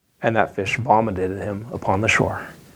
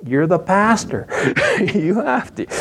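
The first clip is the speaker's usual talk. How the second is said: insisting wife; 8 semitones higher than usual. Retold or imitated.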